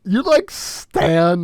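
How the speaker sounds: mock outrage. Real, not acted. Mock outrage at friend's pick.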